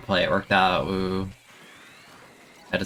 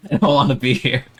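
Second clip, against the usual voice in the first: louder, laughing through the complaint, higher; laughing whine; 5 semitones higher, 8 dB louder than usual. Real, not acted. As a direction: giggle it out as a mock protest.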